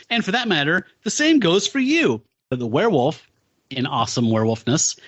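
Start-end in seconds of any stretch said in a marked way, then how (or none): 0.1-2.2 Ghost Rider voice, higher. Retold or imitated. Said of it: "sees the werewolf, like".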